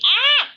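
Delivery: sharp high shriek of alarm; short loud startled scream; startled yelp. Real, not acted. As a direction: yelp as something falls. Startled by tripod or phone.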